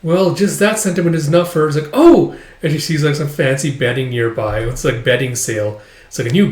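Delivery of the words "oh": high, loud acted "oh"; sudden pipe-up in mock surprise; surprised exclamation. Acted delighted reaction in retelling.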